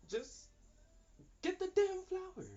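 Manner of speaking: exasperated shout. Retold or imitated. Spirit telling the partner to act, loud exasperated shout. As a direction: yell it like the answer is obvious.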